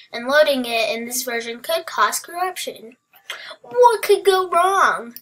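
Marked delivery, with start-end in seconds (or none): 3.6-5.1 high, drawn-out mock-innocent question; mock carefree. Gamer loading a risky world, mock carefree. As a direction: toss it out high and slow, tempting fate. Jokes after corruption warning.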